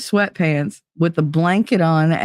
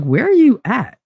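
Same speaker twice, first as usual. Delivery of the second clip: retold indignant demand; 7 semitones higher, 3 dB louder than usual. Retold demand from daughter's father.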